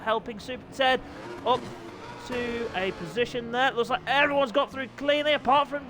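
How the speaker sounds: high, fast-moving race commentary; live play-by-play. Acted. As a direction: call the race start at an excited high pitch.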